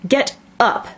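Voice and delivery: high, commanding call; shrill and loud. A narrator's reading. Queen orders the gardeners up, shrilly. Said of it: "in a shrill, loud voice".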